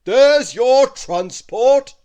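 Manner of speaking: loud, slow booming giant, pitched high; a giant's big, slow welcome; Wizard Winterbottom welcoming. Acted. Giant wizard welcoming Christmas into his castle, loud and slow.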